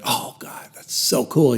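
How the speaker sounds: breathy hushed whisper of thanks; whispered prayer. Retold or imitated. Man retelling his prayer to God, whispering.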